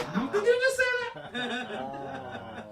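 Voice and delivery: very high, drawn-out disbelief; shocked. Retold or imitated. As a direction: react in stunned slow squeak. Acted shocked reaction to mother.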